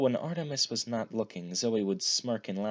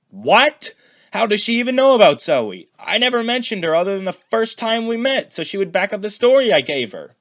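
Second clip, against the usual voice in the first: much higher and louder, shocked outburst; 13 semitones higher, 13 dB louder than usual. A narrator's reading. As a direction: shriek it out in disbelief. Shocked son shrieks.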